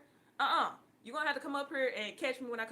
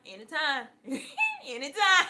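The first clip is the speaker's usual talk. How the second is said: high, loud laugh breaking through the word; giggling mock plea to God; 4 semitones higher, 8 dB louder than usual. Real, not acted. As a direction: crack up mid-plea. Mock pleading with God, cracking up.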